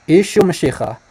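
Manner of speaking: "Ishu Mashiach" as EE-shoo muh-SHEE-kha; Eastern pronunciation. Shown as a demonstration. Teacher repeats the name for listeners, Eastern pronunciation. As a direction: model "Ishu Mashiach" with a rasped kh. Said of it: "Let's slow it down. He says".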